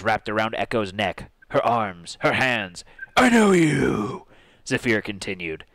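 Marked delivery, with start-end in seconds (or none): 3.2-4.3 higher, drawn-out heroic character voice; earnest hero. Acted. Hero reaching out to possessed friend, earnest character voice. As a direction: call out slowly like an earnest hero. Voices Zephyr reasoning with Echo.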